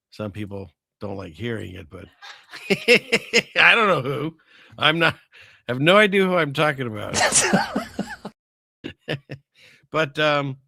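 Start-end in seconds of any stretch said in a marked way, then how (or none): 2.1-3.5 high, loud burst of laughter; amused laugh. Real, not acted. Amused at own confusion. 3.6-7.2 amused. Real, not acted. Laughing at his own confusion. 7.2-8.3 very high-pitched giggling laugh; amused laugh. Real, not acted. Laughing at himself.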